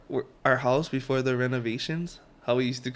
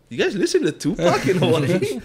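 high, loud amazed question through laughter; mock amazed question; 6 semitones higher, 9 dB louder than usual. Retelling a mainland guy's shock at [name] in Hawaii, mock amazed. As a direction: play up his disbelief, laughing. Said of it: "He was like".

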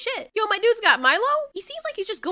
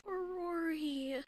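quiet, heartbroken murmur; soft pitying sigh; 12 dB quieter, slower than usual. Real, not acted. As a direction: feel sorry for him, much softer. Pitying a doomed movie character.

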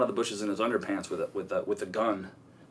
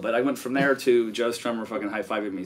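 ordinary delivery

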